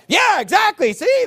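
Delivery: hammed-up excited crowing; mock excited gloat. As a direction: ham up the triumphant gotcha. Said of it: "And they're like".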